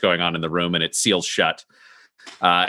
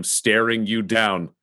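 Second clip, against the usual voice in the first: normal talking voice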